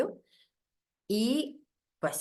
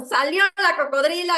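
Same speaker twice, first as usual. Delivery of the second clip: high laughing announcement; laughing boast; 9 semitones higher, 7 dB louder than usual. Real, not acted. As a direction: laugh as the crocodile comes out. Describe su explosión. (riendo, voz alta)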